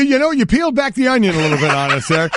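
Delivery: laughing. Real, not acted. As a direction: tease him while cracking up. Teasing guest about halftime story.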